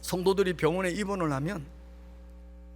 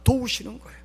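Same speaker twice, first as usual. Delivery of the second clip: loud, higher proclaiming voice; forceful preaching; 7 semitones higher, 8 dB louder than usual. Acted. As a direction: drive the sermon point home loudly. Preacher proclaims the third point.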